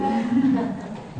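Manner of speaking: a bright high chuckle; high laugh. Real, not acted. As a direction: laugh at the punchline coming. Laughs at own joke.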